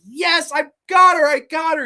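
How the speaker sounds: high, loud victory cheer; hammed-up gleeful yell; triumphant cheer. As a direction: celebrate like winning the Super Bowl. Imitating man celebrating victory.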